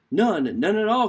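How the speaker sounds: the iguana insists it did nothing; urgent denial; crying out. Acted. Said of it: "cried the iguana".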